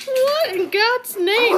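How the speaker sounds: high, loud, drawn-out mock dismay; slow, high-pitched exaggerated disbelief; voicing Dudley's shock. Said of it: "Dudley's like".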